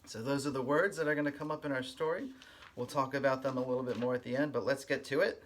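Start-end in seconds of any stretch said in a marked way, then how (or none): none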